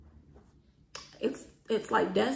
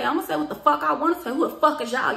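loud, higher, defiant retort; retold cussing-out, voice raised; heated arguing; 6 semitones higher, 6 dB louder than usual. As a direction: argue back, high and forceful. Retold cursing-out argument.

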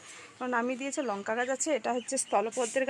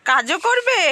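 high, loud incredulous echo; surprised loud question; 8 semitones higher, 11 dB louder, much slower than usual. Real, not acted. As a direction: call back in disbelief. Echoing reply, surprised, loudly.

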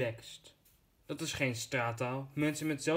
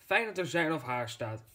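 ordinary talk, nothing marked

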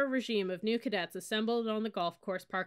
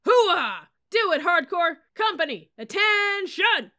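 high, loud rallying shout; drill yell; 8 semitones higher, 10 dB louder than usual. Acted. Sergeant shouting to fire up his cadets. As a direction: pump up the troops at full volume.

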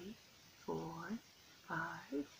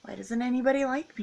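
higher, quick hurt question; pleading; 5 semitones higher, 5 dB louder than usual. Acted. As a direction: plead like a lonely little fish. Lonely fish pleads with starfish.

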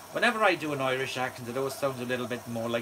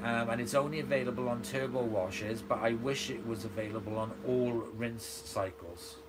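usual speaking voice

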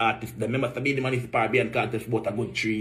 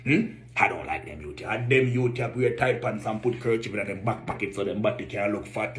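ordinary talk, nothing marked

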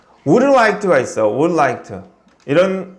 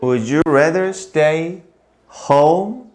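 slow model question; much slower than usual. Shown as a demonstration. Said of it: "teacher models question".

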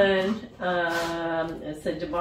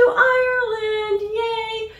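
high sung yay; excited cheer; 14 semitones higher, 6 dB louder, much slower than usual. Real, not acted. Vlogger cheering her viewers about Ireland, sung excited yay. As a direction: cheer it up high like a little song.

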